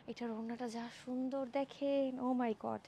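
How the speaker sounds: soft, drawn-out admiration; hushed awe. Real, not acted. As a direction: gush softly, stretching the praise. Hushed gushing over a beautiful scarf. (মুগ্ধ, চাপা গলায় টেনে টেনে)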